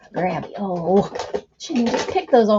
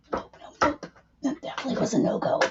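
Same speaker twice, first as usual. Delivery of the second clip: fast whispered nope; whispered mutter; 4 semitones higher, 4 dB quieter, faster than usual. Real, not acted. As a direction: hush it out quickly.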